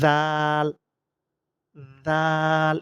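জাল with voiced th and long level aa; tongue-tip জাল. Shown as a demonstration. Teacher says it comes from the same place, drawn-out tongue-tip জাল. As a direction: buzz the th, then hold the aa in জাল. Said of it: "এইভাবে উচ্চারণ করতে হবে".

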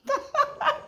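squeaky burst of laughter; high laugh. Real, not acted. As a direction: laugh high at her own joke. Após imitar o cachorro. (risada aguda)

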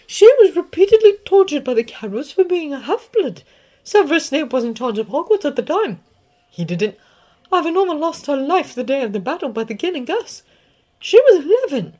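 a stern witch recounting a loss; McGonagall. Acted. McGonagall recounts student's death.

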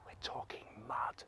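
unvoiced whisper to let them in on it; hushed reveal. Storyteller reveals the kind of mess, whispered. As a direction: whisper it very softly.

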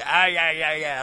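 loud scoffing yeahs; mock antagonistic grunt; harsh antagonistic tone. Shown as a demonstration. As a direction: sneer it like a hostile heckler. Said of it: "like this".

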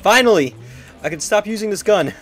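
loud, high burst of relief; relieved shout. Real, not acted. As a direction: shout it out, finally free. Objective done in game.

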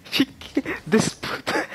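high-pitched attempt at a Colombian accent; badly faked Colombian accent, cracking up; rough Colombian accent. Retelling the kicked mafia guy, high mock Colombian accent. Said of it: "I'm not doing the accent properly".

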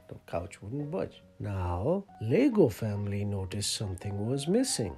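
usual speaking voice

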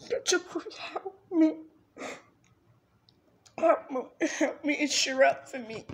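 loud, broken cry for help; tearful plea. Real, not acted. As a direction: beg for help through sobs. Tearful plea after sobbing.

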